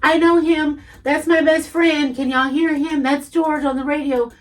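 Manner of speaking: proudly calling it out, half-acted; a hint of loud proud friend; retold loud bragging. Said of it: "I would be so loud and be like".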